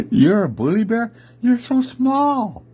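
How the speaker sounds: very high, teasing bear voice, last word drawn out; incredulous high-pitched taunt; jolly bear mocking. A narrator's reading. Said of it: "the big jolly bear".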